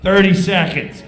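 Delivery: loud countdown; stage manager calling thirty seconds. Retold or imitated. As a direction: bark the time left.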